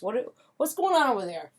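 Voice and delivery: lightly voiced puzzled pup, climbing in pitch; curious dog voice. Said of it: "the dogs will come over and they're curious".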